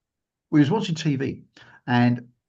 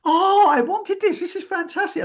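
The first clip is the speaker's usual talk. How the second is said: delighted gush; 3 dB louder than usual. Retold or imitated. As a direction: coo over the gift like a dream come true. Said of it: "She went".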